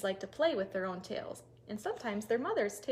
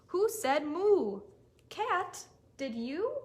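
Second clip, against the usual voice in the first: curious question; 5 semitones higher, 4 dB louder, much slower than usual. Acted. Storyteller questions animals.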